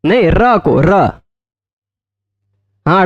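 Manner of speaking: ordinary delivery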